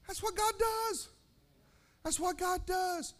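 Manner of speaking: high, slow, drawn-out celebration; happy exulting. Retold or imitated. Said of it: "I get ha-, happy".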